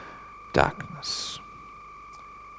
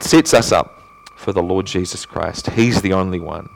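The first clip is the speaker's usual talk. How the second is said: ordinary talk, nothing marked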